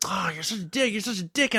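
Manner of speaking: high-pitched nagging imitation; mock scolding friends. Imitating friends scolding him for leaving.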